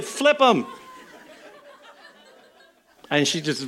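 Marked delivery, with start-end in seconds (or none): 0.0-0.6 sarcastic. Retold or imitated. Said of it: "that is so sarcastic".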